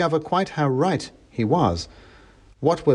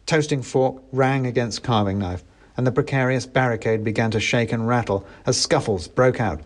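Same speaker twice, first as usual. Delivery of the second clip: plain reading voice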